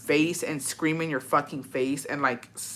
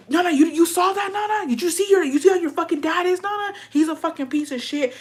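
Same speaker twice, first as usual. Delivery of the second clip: high-pitched mock tirade; imitated ranting mom; 11 semitones higher than usual. Mom ranting to her child about dad, high imitated scolding. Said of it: "She's like".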